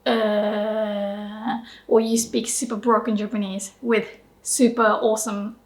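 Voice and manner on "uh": acted blank hesitation; stumped "uh". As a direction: drone a hesitant uh, at a loss. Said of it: "And then you're like".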